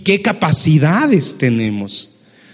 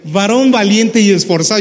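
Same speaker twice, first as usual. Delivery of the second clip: sarcastic; 4 semitones higher, 3 dB louder than usual. Retold or imitated. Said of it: "usó un sarcasmo acá".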